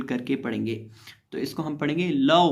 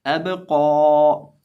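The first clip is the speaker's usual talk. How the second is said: कौ stretched very long and loud; drawn-out कौ in recitation; long drawn vowel; 7 dB louder, much slower than usual. Shown as a demonstration. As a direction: hold the vowel of कौ out for a long time. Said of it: "कौफ खड़ी ज़बर कौ".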